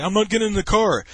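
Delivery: put-on high, loud drunken ranting; shouted, worked-up refusal; freaking out. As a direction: imitate a drunk friend in a panicked tirade. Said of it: "he's freaking out and shit".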